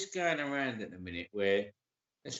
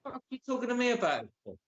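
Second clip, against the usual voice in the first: fast mock retort; 9 semitones higher, much faster than usual. Acting out reply to accusers.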